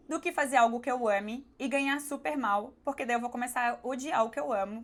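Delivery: ordinary talk, nothing marked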